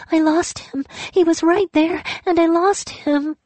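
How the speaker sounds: very high keening grief; grieving wail. Acted. Lost spirit keens over someone lost, very high. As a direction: repeat it as if it breaks you.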